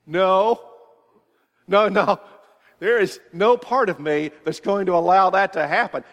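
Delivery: firm loud no. Retold or imitated. Father refusing daughter's mall request.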